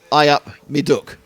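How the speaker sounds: slow, lightly voiced Nottingham "I up me duck"; Nottingham greeting. Commentator greeting the Nottingham colleague in a light local accent. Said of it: "our Nottingham resident".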